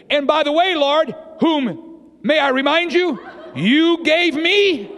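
high, pointed mock blame, slowing at the end; blaming. Adam blames God for the woman, high-pitched pointed blame. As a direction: pin it on the listener, stretching the last words. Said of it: "he blamed the woman and God".

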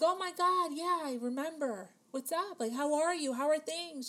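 high, bright burst of mock delight; gushing, excited hello; excited recognition. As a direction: squeal a thrilled hello to an old acquaintance. Excited reunion quote to old acquaintance.

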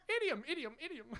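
high, playful run of a new word; playful repetition. Real, not acted. Preacher teasing himself over a newly learned word, playful.

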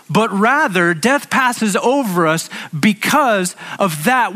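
impassioned pulpit delivery; preaching at full force. Real, not acted. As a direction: preach it with rising fervour.

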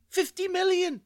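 high-pitched incredulous exclamation; appalled outcry. Acted. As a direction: cry out, appalled at the waste. Appalled at the wasted pints.

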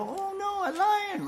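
high squeaky pretend fright, then a roar; hammed-up fear for a toddler; mock scared. Pastor pretending the toddler's lion scares him, high mock fright. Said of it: "I go".

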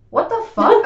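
high, loud, shocked outburst; shocked yell. Retold or imitated. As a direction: blurt it out in shock. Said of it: "And I was like".

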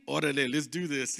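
low homie voice. Acted. Archangel backing the youth up, low homie voice. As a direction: drop low, ready for a fight. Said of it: "Michael the archangel looking at him saying".

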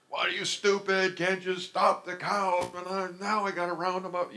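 high-pitched imitation of a scolding father; high, put-on voice of an exasperated parent; exasperated dad. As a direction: mimic Dad berating his kid. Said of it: "my dad was always calling me stupid".

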